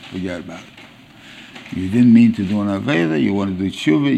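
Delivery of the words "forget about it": soft, slow brush-off; soft, slow forget about it; casual dismissal. Retold or imitated. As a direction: wave it away gently, slowly. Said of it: "people say".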